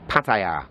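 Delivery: PATTAYA as pat-eye-YAH, long open final syllable; pronunciation one. Shown as a demonstration. Vlogger repeating the preferred way, Pattaya as pat-eye-YAH. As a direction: say Pattaya with TIE in the middle and a drawn-out YAH. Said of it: "Number one".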